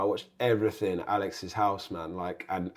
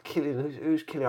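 high, softer baffled query; lightly mimed confusion, pitched up; retold puzzlement; 5 semitones higher, 4 dB quieter than usual. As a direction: act out not placing the name. Retells not recognising the name.

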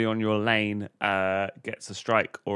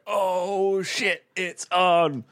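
higher, slow mock alarm; mock competitive shock; 9 semitones higher, 4 dB louder, much slower than usual. Seeing Pete strike again, a slow mock-alarmed challenge. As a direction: draw it out like a rival accepting a challenge. His reaction to friend's streak.

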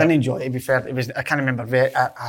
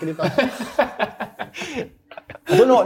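laughing; 10 semitones higher, much slower than usual. Real, not acted. Laughing at the tattoo story.

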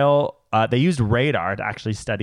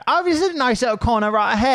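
much higher, fast put-on British accent; British tourist accent; 12 semitones higher, faster than usual. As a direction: play a British tourist admiring a corner. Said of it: "pretend I'm a tourist".

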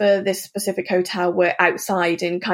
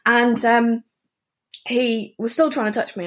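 usual speaking voice

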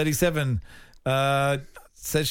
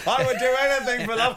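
mock shouting; 10 semitones higher than usual. Joking about lyrics shouted in sleep, mock cry. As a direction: pop lyric climbing to a high cry. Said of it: "shouts out saucy pop lyrics".

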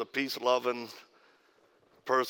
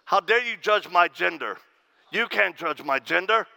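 mock outrage, louder and higher; raised indignant voice; 4 semitones higher, 5 dB louder than usual. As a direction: imitate an offended man raising his voice. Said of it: "raises his voice and says".

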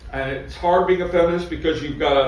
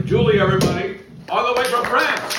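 hype intro; 6 semitones higher than usual. Real, not acted. Host introducing comic to crowd.

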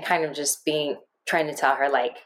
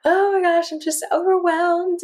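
high-pitched overwhelmed wail; overwhelmed gush; 13 semitones higher, 4 dB louder than usual. Retold or imitated. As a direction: squeal it out, flooded with it all. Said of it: "And I'm like".

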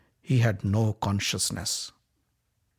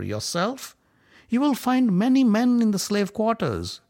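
mockery; 6 semitones higher than usual. A narrator's reading. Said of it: "Karna's mockery".

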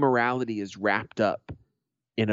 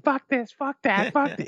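rattled-off curses, very high pitched; cursing street locals. Retold or imitated. Imitating everyone on the street back home, high-pitched cursing. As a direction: mimic a crowd swearing, voice pushed way up. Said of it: "everyone's on the street like".

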